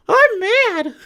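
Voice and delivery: very high crybaby complaint; high, hammed-up petulant whine; mock whining. Mocking the complainers again.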